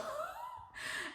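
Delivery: sudden high burst of laughter. Real, not acted. Laughs after saying ow.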